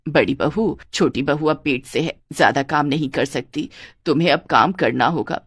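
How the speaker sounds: put-on low, firm older-woman voice; instructing mother-in-law. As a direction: lay down the rule as mother-in-law. (सास की नीची, आदेश भरी आवाज़)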